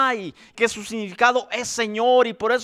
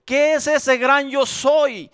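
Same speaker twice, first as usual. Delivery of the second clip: ordinary delivery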